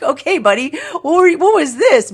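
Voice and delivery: high, loud, amused confusion through laughter; laughing bafflement. Retold or imitated. Puzzled by fear's weird message, laughing loudly. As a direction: chuckle at a weird gift you don't get.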